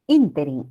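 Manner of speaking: Ínterin stressed on the first syllable; Ínterin said as ÍN-te-rin; esdrújula stress. Shown as a demonstration. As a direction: hit the ÍN in Ínterin, then fall away. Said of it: "esdrújula".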